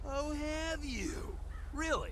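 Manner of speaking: high, drawn-out incredulity; mock interest. Man answering his friend's lifestyle remark, mock-interested.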